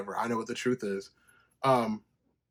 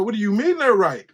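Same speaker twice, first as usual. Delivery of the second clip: higher and louder, defensive pushback; retold defensive outburst, raised voice; 9 semitones higher, 10 dB louder than usual. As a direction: push back hard, stung and defensive. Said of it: "I'm get defensive and shit".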